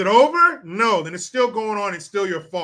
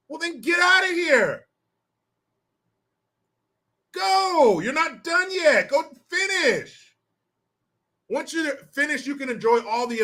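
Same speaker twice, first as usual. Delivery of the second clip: mock-stern parent yell, pitched up high; parent yelling at kids; 7 semitones higher than usual. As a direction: bark the kids back to their chores. Parent yelling kids back to chores.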